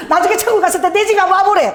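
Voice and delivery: high-pitched mock boast; boasting mother-in-law. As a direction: relay the brag with comic relish. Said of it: "우리 어머니가".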